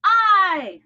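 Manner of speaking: loud, high shout; belted-out classroom answer. Shown as a demonstration. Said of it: "Shout it out".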